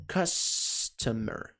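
very slow, low and soft; "customer" stretched syllable by syllable; slow model word. Shown as a demonstration. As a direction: draw the word out for learners.

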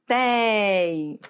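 "tei" held long on its ei; sustained "tei"; stressed syllable demo. Shown as a demonstration. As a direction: stretch "tei" out, letting the ei ring. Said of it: "Repare, é o ei".

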